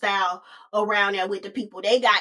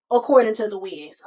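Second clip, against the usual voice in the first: usual speaking voice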